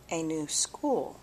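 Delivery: plain reading voice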